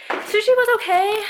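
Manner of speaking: breathless and high; puffing between words; out of breath. Real, not acted. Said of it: "I'm out of breath".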